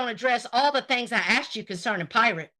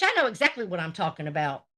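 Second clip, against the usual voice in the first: ordinary talk, nothing marked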